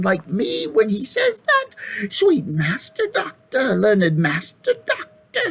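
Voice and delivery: put-on high, wheedling old hag voice; shrill whining hag. Hag flatters the king and doctor, high and wheedling. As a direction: fawn on them like a crooked old hag. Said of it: "the shrill, whining voice began".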